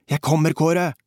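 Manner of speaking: high, urgent call-out; urgent roar. A narrator's reading. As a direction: call across the river to a friend. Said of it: "brølte jeg".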